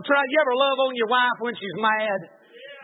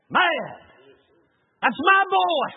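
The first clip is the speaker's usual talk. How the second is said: furious shouting; 7 semitones higher than usual. Retold or imitated. Furious at God over son.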